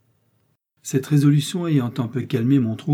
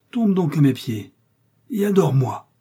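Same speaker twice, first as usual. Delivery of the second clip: raised, commanding cry; terrible voice; 5 semitones higher, 4 dB louder than usual. A narrator's reading. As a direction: narrate a fearsome command, higher and a little louder. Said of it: "me cria-t-il d'une voix terrible".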